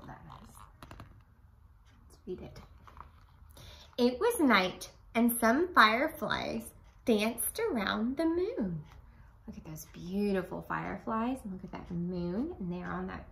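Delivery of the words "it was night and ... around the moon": storybook reading. A narrator's reading. Teacher reads story aloud.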